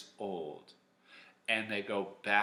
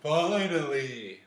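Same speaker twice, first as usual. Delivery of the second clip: loud, drawn-out cheer of relief; Audrey; 6 semitones higher, 8 dB louder, much slower than usual. Pretend. Teacher imagines Audrey glad the judges are done, mock relieved cheer. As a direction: exclaim it high, stretched out in mock relief. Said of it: "I can picture Audrey, like, going".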